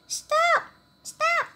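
a little mouse crying out in panic; frantic high cries. Acted. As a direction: yell for the pot to stop. Mouse trying to stop the pot.

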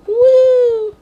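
loud, high, sustained woo; high whoop. Real, not acted. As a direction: let out a long, high whoop. Reacting to frozen pizza.

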